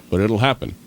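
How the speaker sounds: ordinary talk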